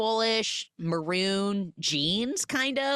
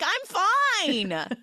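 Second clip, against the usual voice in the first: mock dad; 11 semitones higher, 3 dB louder than usual. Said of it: "to be like".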